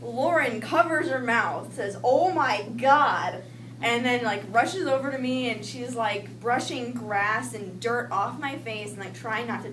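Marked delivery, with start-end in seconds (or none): 2.0-3.4 loud, drawn-out horrified reaction; shocked exclamation. Retold or imitated. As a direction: stretch it out in shock, retelling a friend. Friend sees her injured face.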